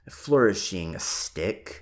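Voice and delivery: ordinary delivery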